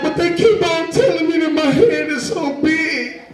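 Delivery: put-on high sing-song little boy complaining; whiny kid. Little boy telling his dad about the head jokes. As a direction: whine it up high like a hurt kid.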